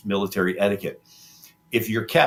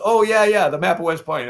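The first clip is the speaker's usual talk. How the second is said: flustered bluff; 8 semitones higher, 6 dB louder than usual. Retold or imitated. Caught spy bluffing to captors.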